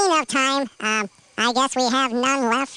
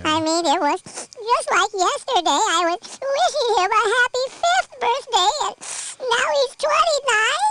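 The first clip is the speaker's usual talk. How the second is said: crying over a grown-up son; snotty crying; 9 semitones higher than usual. Acted. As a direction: voice rising into weepy sobbing. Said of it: "Oh, my gosh. Here's my hanky. Blow your nose".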